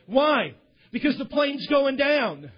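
forceful preacher's punchline; emphatic climax. Acted. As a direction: drive the answer home loudly. Emphatic climax of parachute illustration.

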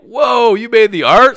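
loud, high, astonished question; retold amazement. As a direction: react as a starstruck fan. Retold amazement at meeting the artist.

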